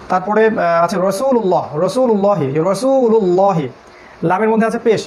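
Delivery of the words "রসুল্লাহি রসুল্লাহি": রসুল্লাহি slow, with a heavy, thick ল. Shown as a demonstration. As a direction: say রসুল্লাহি with a full, dark ল. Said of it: "রসুল্লাহ পড়লাম".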